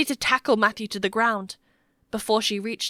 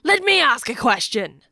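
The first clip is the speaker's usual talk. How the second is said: loud, raised-pitch interruption; interjecting; 4 semitones higher, 6 dB louder than usual. A narrator's reading. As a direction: cut in forcefully over the others. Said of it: "the balding man interjected".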